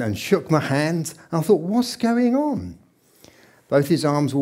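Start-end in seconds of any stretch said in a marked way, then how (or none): 1.6-2.8 high, drawn-out puzzled wonder; amazed. Retold or imitated. Visitor seeing his sick friend recovered, retold amazement. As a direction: stretch the question out in mock amazement.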